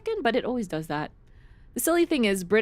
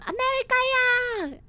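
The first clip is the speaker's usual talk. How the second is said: very high, squealed meme chant; high-pitched mock cheer; meme imitation. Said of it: "that moment in that little meme".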